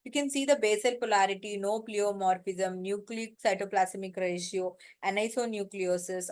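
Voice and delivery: ordinary delivery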